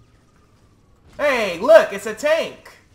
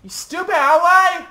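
loud, high angry yell; yelling across the room; 9 semitones higher, 6 dB louder than usual. Real, not acted. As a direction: scold the clumsy ally loudly. Yelling at friendly AI ally.

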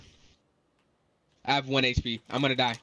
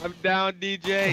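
urgent callout; 7 semitones higher, 4 dB louder, much slower than usual. Real, not acted. Teammate downed in fight.

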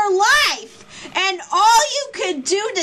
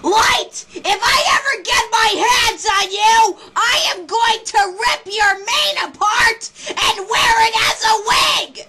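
furious shout. Real, not acted. Fan raging at Light on screen, furious shout. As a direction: scream threats at the villain.

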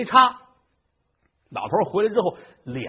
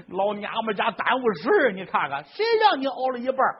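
put-on scolding old man; 7 semitones higher than usual. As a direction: blame her for half a dose. Old man slaps and scolds wife.

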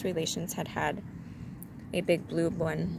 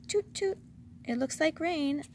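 very high little voice; lifted, play-acting pitch; acted saying; 10 semitones higher than usual. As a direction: say it in a high pretend voice. Pooh acts out what to say.

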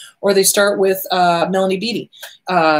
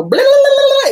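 very high, loud tongue-wagging babble; inner critic babble; 8 dB louder than usual. Acted. Inner critic popping up to nag, loud high babble. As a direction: burst out in nonsense blah-blah, pitched way up. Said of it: "this inner critic gonna pop up and go".

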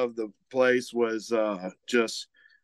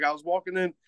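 higher, light imitation of a Cajun accent; 7 semitones higher than usual. Retelling a team captain's words, in a mock Cajun accent. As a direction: put on a friend's Cajun slur, half-joking. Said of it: "emulate his accent".

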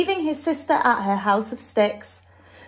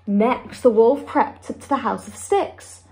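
ordinary delivery

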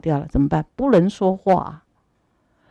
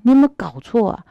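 higher and louder indignant argument; heated protest; 6 semitones higher, 5 dB louder than usual. Acted. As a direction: argue back hard for the wronged youth. Fake god protests to true god.

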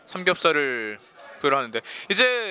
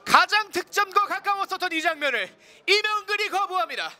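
booming prize announcer; 8 semitones higher than usual. Real, not acted. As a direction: shout the keeper's save. A diving goalkeeper save.

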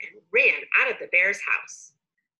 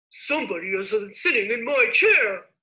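Papa Bear roar; 5 dB louder than usual. A narrator's reading. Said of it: "roared Papa Bear".

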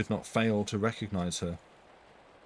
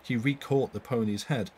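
plain reading voice

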